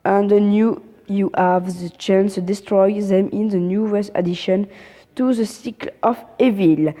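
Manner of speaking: English read with a heavy French accent; stumbling through English in a thick French accent; bad English accent. Real, not acted. Reading game update notes aloud to viewers, in French-accented English. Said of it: "désolé pour mon accent anglais pourri".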